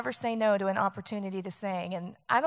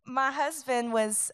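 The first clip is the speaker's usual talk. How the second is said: ordinary talk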